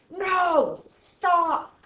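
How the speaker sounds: high, drawn-out loud yell; screaming bracket. Acted. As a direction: scream a warning to halt. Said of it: "that end bracket screams at you".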